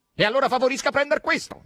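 high, loud, insistent offer; brisk command. A narrator's reading. Asasello hands Margherita the box, insistent. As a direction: press it on her emphatically.

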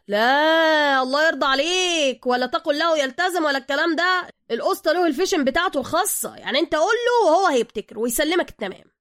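urgent Soka. Acted. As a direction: cut him off and plead fast.

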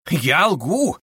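high-pitched mock indignation; heated, mocking. A narrator's reading. Investigator retorting to his suspect, heated mockery. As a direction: throw the accusation back, amused. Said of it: "подхватил Порфирий, по-видимому, горячась, но сохраняя самый весёлый и насмешливый вид".

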